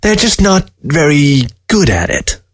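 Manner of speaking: high, mocking talking-fox voice; a scornful dead fox sneering at the man; sly fox voice. Taxidermy fox scoffing at the old man, high and sly.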